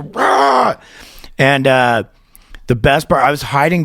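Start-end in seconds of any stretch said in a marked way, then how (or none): 0.0-0.8 high, comic roar of a wild singer; mock growl roar. Singer grabbing a fan, hammed-up roar. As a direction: pounce with a cartoon rah. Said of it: "just grab the guy and just go".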